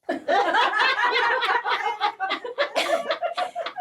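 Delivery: long, high hammed-up laughter; acted laughing fit. Laughing when the delivery room door opens, hammed-up high laughter. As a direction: burst into mock hysterics, pitched high. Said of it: "and I'd be like".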